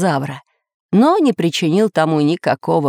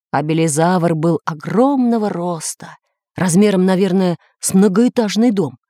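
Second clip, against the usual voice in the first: ordinary delivery, nothing marked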